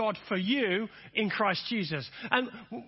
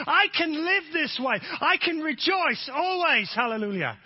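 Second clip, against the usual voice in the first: higher, louder rallying preacher; full-force preaching; 5 semitones higher, 3 dB louder than usual. Acted. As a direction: lift the congregation, building. Preaching builds to a climax.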